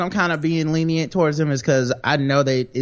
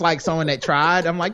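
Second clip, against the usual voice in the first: ordinary talk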